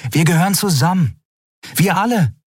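put-on high, earnest young woman's voice; young woman, pleading. A narrator's reading. Endriel insists. (hohe, eindringliche junge Frau)

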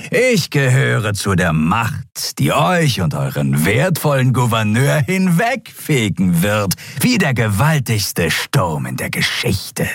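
defiant cultist's proclamation; fanatical reptile captive. Acted. Captive reptile threatening the governor, fanatically. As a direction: proclaim doom like a zealot.

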